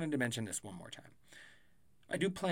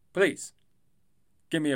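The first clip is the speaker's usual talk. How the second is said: "please" higher and louder; emphatic louder please; 3 semitones higher, 9 dB louder than usual. Shown as a demonstration. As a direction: punch the second "please".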